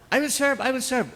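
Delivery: very high-pitched eager squeal; eager volunteers. Retold or imitated. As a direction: pipe up like overeager seminarians vying to be picked. Eager seminarians volunteering to serve. (sabik na nagboboluntaryo, matinis)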